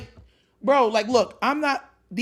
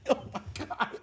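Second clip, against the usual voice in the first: breathless laughing; 13 dB quieter, faster than usual. Real, not acted. Laughing disbelief.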